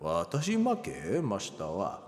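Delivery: low, echoing recital. Shown as a demonstration. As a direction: let it resonate low through an echo. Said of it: "Shall we do this with an echo?".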